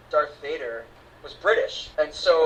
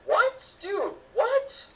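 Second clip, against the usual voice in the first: shocked disbelief; much slower than usual. Retold or imitated. Reacting to the twist.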